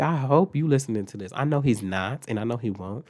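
ordinary delivery

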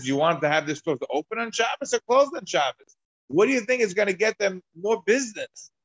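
rising, coaxing sales pitch; persuasive prodding. Retold or imitated. Reuven nudging the officials toward his argument, coaxing. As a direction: lead them on with a knowing push. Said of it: "He says".

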